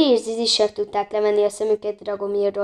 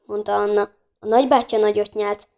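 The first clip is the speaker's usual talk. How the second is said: usual speaking voice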